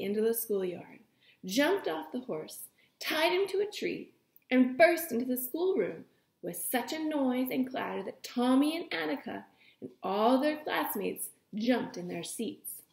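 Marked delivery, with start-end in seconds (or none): none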